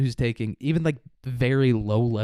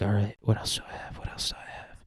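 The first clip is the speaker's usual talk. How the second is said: whispered to himself; God whispering over his list; 4 semitones lower, 6 dB quieter than usual. Retold or imitated. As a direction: whisper it while thinking.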